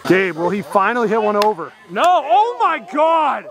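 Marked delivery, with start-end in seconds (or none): 1.9-3.4 high, drawn-out astonishment; stunned disbelief. Real, not acted. Commentator stunned by a long hit, astonished cry.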